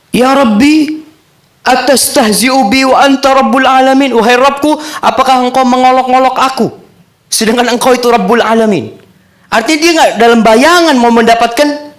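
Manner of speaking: high, loud astonished cry to his Lord; amazed servant. Acted.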